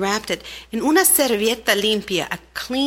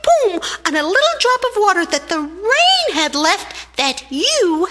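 much higher, loud, fast ant demanding justice; indignant little ant; 13 semitones higher, 4 dB louder than usual. Acted. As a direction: scold the rain like a tiny furious ant. Ant confronts the cloud.

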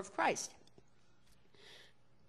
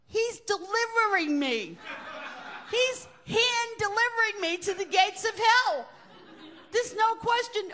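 put-on a scared little girl's thoughts, pitched high; child's inner panic; 12 semitones higher, 6 dB louder than usual. Girl being dragged along, fearing the gates of hell. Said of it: "I'm saying to myself".

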